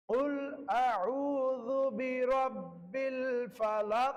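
slow, measured Quranic recitation; teacher reciting an ayah; slow recited verse. Shown as a demonstration. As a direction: intone the verse slowly for students to copy. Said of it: "দুই তিনটা করে অথবা একটি আয়াত".

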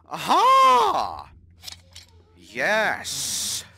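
loud high triumphant cry; triumphant shout. Real, not acted. Found the item.

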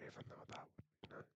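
very soft, quick whisper; secret admission. Acted. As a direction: let it slip under your breath, barely audible. Secret admission under breath.

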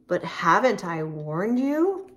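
ordinary delivery

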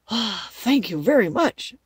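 loud, slow, grateful scarecrow; relieved scarecrow. Acted. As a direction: draw out the thanks with relief.

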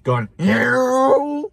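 high revving engine whine; mock engine rev; engine noise. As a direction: imitate the car roaring closer. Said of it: "I hear it even louder coming toward me".

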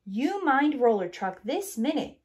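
a parent's command; clanging. A narrator's reading. Crane clanging a scolding at bulldozer. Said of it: "clanged Crane".

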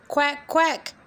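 high quacking call; duck quack. Acted. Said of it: "called the duck".

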